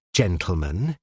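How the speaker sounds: gentleman said softly, with sinister weight; the judge lets gentleman drop soft and sinister; soft sinister emphasis. Acted. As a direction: lean on gentleman quietly and pointedly. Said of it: "soft, sinister emphasis on the word gentleman".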